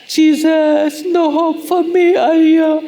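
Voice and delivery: loud despairing cry to Jesus; a hammed-up hopeless shout; frustrated outburst. Acted angry outburst at others.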